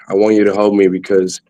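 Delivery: ordinary talk, nothing marked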